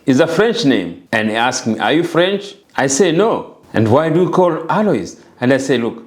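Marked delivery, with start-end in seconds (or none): none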